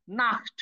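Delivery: নাখট with ch said as a throaty kh; kh sound in নাখট. Shown as a demonstration. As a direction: say নাখট with a Bengali খ sound before the final t. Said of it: "খর মতো উচ্চারণ করতে হবে". (নাখট-এ খ-এর মতো উচ্চারণ)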